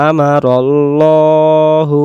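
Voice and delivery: very slow chant with a heavy, full l in Allah; Quran recitation. Shown as a demonstration. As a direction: hold each syllable long. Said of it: "teacher chants the verse". (মোটা করে টেনে তিলাওয়াত)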